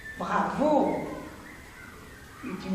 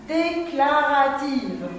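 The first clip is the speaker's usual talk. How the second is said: drawn out; much slower than usual. Shown as a demonstration. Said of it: "announcing the answer".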